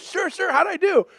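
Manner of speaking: high, clueless cheerful reply; clueless Brent. Retold or imitated. Brent answering the school officials, lightly voiced and high. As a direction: play dumb, cheerfully oblivious. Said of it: "Brent answering officials".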